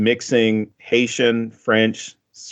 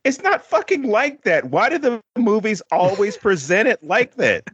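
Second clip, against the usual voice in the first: high, exasperated mock locals complaining; a mock of indignant locals, much higher than usual; 9 semitones higher than usual. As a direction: voice the fed-up locals, pitched way up. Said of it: "They're like".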